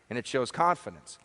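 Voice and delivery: ordinary delivery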